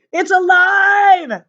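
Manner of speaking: high, slow shriek of fright; teacher shrieking at a crawling cootie; terrified scream. A narrator's reading. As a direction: scream it out in horror. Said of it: "she screamed".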